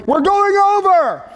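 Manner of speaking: panicked high yell. Retold or imitated. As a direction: shout it in sudden panic. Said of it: "by him yelling".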